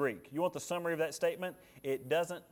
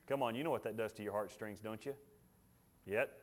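usual speaking voice

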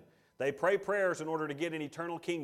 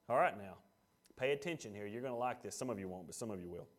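hushed aside; 7 semitones lower, 8 dB quieter, faster than usual. Real, not acted. Confiding aside to congregation.

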